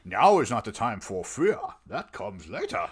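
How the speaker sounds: put-on movie villain voice; Bane. Co-host showing whom Vader sounded like, villain impression. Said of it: "Bane vibes from The Dark Knight Rises".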